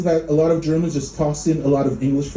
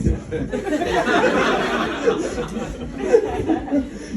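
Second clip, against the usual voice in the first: breathy high giggle; 13 semitones higher, 3 dB quieter than usual. Real, not acted. Long laugh after the rant.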